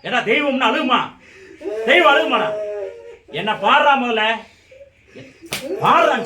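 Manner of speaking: high, loud rebuke; loud scolding. Real, not acted. Medium scolds the one crying as a god, loud scolding. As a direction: scold with ringing authority.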